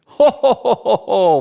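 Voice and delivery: high, loud run of ho-hos; Santa sign-off laugh. Acted. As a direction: laugh merrily like Santa saying goodbye.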